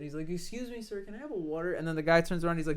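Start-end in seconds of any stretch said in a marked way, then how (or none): 0.3-1.7 higher, softer imitation of a timid customer; leaning-in polite request. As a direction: politely try for attention in a small high voice. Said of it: "he leans over a little bit".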